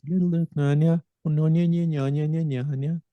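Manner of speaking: high Brummie sing-song babble; high-pitched mock Brummie melody; musical Brummie accent. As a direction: imitate the musical lilt of Brummie. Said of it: "It's very, very musical accent".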